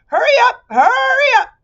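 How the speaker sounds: very high, loud squealing hurry; impatient bee shout. Acted. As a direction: bounce with impatience, almost crying it out.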